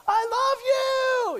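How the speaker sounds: high, loud fan scream from the crowd; shrieking concert fan; fan yelling. Retold or imitated. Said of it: "and yells that out".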